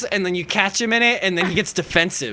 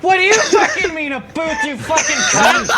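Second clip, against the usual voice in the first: hammed-up angry comeback; mock yell; 8 semitones higher than usual. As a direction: shout it back in mock fury. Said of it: "I'm like".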